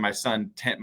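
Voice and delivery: ordinary talk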